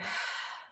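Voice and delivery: long audible exhale; voiceless releasing sigh; demonstrated sigh. Shown as a demonstration. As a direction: sigh the breath out to show the class. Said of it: "perhaps even sighing out here".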